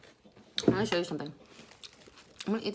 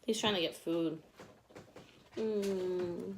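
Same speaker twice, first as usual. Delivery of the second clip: ordinary delivery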